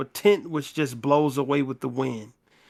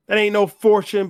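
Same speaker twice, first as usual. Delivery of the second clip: loud, higher scoff; scoffing outburst; 6 semitones higher, 7 dB louder than usual. Acted. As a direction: throw the fortune back at them, loud.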